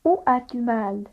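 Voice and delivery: ordinary delivery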